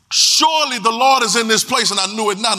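preaching. Acted. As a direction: stretch each word of the verse. Repeats verse with emphasis.

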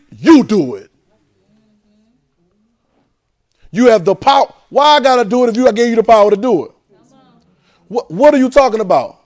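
loud, high retort; God fed up; exasperated God. Acted. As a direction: throw it back at him. God's loud reply.

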